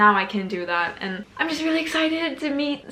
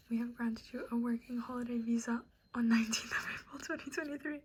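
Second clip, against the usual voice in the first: whispered reading; 12 dB quieter than usual. Real, not acted. Reading the visa email.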